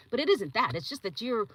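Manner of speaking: ordinary talk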